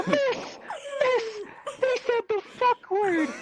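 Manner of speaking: very high, slow tattletale squeak; exaggerated squeaky schoolkid complaint; mock tattling. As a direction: tattle breaking off between words, voice way up. Gleeful tattling again.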